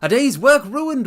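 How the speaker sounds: Holmes bursting out high and loud in irritation; impatient exclamation. Acted. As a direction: throw up your hands at a ruined day's work. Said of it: "an exclamation of impatience".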